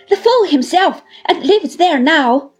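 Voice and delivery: high, loud scorn; disdainful. A narrator's reading. Uriah answers Micawber's question about the house, disdainful. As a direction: throw it out loudly with disdain. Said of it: "said Uriah disdainfully".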